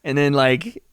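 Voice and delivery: usual speaking voice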